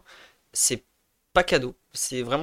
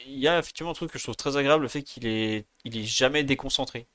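ordinary talk

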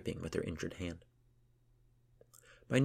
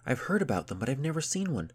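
higher, eager excited gush; overjoyed; 7 semitones higher than usual. A narrator's reading. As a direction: gush with delight. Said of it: "Yadith was overjoyed".

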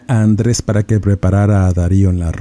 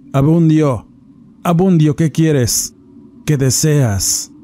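higher, urgent summoning call; 6 semitones higher, slower than usual. A narrator's reading. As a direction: call the spirit by name. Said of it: "gritaba Cayetana".

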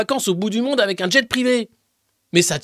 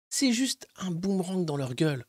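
whispered; 7 dB quieter than usual. Real, not acted. A biting aside.

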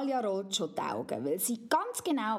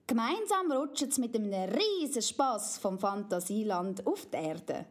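usual speaking voice

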